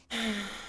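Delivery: soft sigh of frustration; weary sigh. Real, not acted. Streamer stuck on a hated puzzle, weary sigh. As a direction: let out a fed-up sigh.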